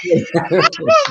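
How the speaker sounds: high, loud burst of laughter. Real, not acted. Host loving the movie line: loud laugh.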